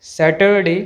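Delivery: सैटरडे with an audible r; sounded r. Shown as a demonstration. Teacher showing the wrong way, r sounded. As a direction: pronounce the r in सैटरडे. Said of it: "गलत है".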